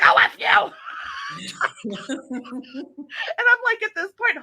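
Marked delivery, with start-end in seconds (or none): 0.0-0.7 mock snap. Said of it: "and she's like". 0.8-1.8 squealing laugh, much higher; high burst of laughter. Real, not acted. Host cracking up over her friend's outburst, high squeal of laughter.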